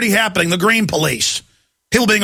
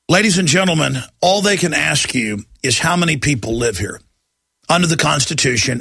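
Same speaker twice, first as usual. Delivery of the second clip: ordinary delivery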